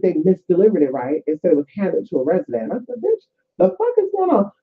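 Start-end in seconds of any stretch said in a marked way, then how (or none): 3.0-4.5 high indignant squawk; mock outrage. Telling off the package mess in a high outraged squeal. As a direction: blurt it out, much higher, scandalized. Retelling outburst at package news.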